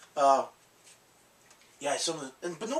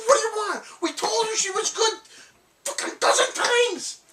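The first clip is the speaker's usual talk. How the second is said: exasperated rant; 5 dB louder than usual. Retold or imitated. Explaining to Jimmy why he told her off, high and exasperated. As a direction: vent the frustration.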